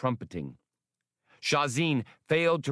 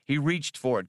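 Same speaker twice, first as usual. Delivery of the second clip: ordinary delivery, nothing marked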